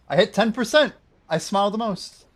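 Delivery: loud gleeful climax; gleeful shout. Acted. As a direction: shout it out with glee. Escalating gleeful bit.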